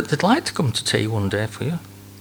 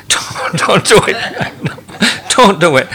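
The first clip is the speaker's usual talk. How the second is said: loud warning; 8 semitones higher, 7 dB louder than usual. Real, not acted. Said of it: "warning the congregation".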